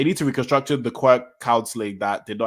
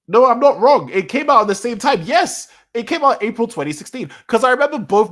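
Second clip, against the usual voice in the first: higher and louder, suddenly sure of himself; excited "I'm not wrong"; 9 semitones higher, 7 dB louder than usual. Real, not acted. Streamer to chat, fixing his own correction, excited and loud. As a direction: burst out with it once you realize you were right. Realizing he was right after all.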